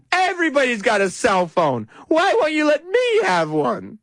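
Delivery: high, hammed-up teen pleading; whining teenager. Host as teen begging parent for a cell phone, whining teenager. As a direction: whine it like a teen denied a privilege. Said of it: "acting like a teenager".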